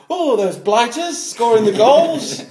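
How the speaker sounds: high, loud, slow mock indignation; comically genteel outrage; hammed-up polite swearer. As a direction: exclaim like a prim footballer trying to be aggressive. Mild self mimicked among aggressive players.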